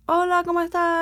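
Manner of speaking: put-on Spanish with a British accent. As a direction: say Hola in a posh British way. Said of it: "So they speak like".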